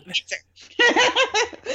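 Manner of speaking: HITS HER high, through laughter; laughing air quotes. Real, not acted. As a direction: squeal HITS HER while cracking up. Repeats air-quoted words, laughing.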